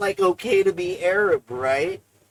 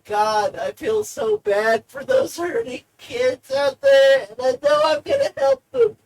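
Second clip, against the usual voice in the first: mock heartfelt; 10 semitones higher than usual. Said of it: "It's more like".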